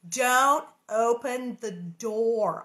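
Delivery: slow, drawn-out spooky warning; mock warning. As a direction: drag it out like a horror-film warning. Mock warning quote in reading.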